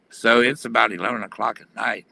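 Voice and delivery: normal talking voice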